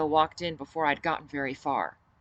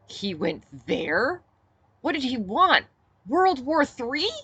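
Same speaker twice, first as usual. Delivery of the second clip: slow, much higher and louder disbelief; incredulous; 9 semitones higher, 6 dB louder, slower than usual. Acted. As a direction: stretch out the shock, can't believe he said it. Narrator incredulous at Griffin.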